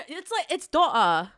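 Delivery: "daughter" said the British way, slow. Shown as a demonstration. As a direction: model "daughter" as tɔtə, dropping the r. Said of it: "Oh, daughter".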